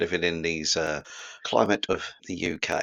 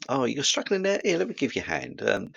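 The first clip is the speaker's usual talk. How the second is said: higher-pitched, kindly offer of help; gentle and reassuring; softer, gentle tone; 5 semitones higher than usual. Shown as a demonstration. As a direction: gently offer a helping hand. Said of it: "there's a softer tone".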